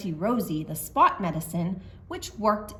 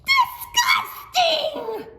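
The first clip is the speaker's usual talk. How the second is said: high, loud, drawn-out, laughing shout; disgusted shout; 7 dB louder, much slower than usual. Acted. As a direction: squeal it in playful disgust. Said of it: "shouted Little Red".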